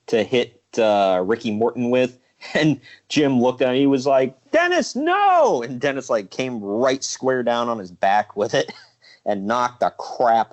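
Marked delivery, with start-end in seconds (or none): 4.5-5.6 high, drawn-out mock cry of alarm; retold alarmed cry. Retelling a wrestling manager's warning to his partner, mock alarmed cry.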